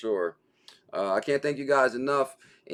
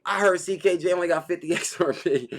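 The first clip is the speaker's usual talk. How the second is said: lightly voiced mocking tease with a laugh, a bit higher; mocking quote; 6 semitones higher than usual. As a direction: tease it with a chuckle. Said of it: "He was like".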